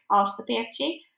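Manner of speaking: usual speaking voice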